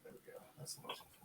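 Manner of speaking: soft whispered mutter; whispered aside. Real, not acted. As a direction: whisper it to yourself. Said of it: "GM muttering to himself".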